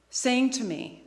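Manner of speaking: ordinary talk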